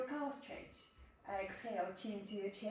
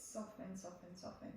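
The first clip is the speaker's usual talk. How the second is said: softer and softer, trailing off; soft soothing cue; 3 semitones lower, 10 dB quieter than usual. Shown as a demonstration. Yoga teacher melting the class's shoulders, softly fading. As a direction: breathe the words out like letting go.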